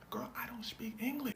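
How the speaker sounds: hushed, confused answer; whispered reply. Retold or imitated. Family telling Lindsay they don't understand, whispered. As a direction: whisper it back baffled. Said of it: "They go".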